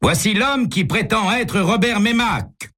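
high, resounding proclamation. A narrator's reading. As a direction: lift the pitch high and announce it to the hall. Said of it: "le ton de sa voix s'élève et retentit".

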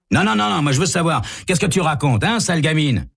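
bully her, heated; angry thug. Acted. Ray angrily presses.

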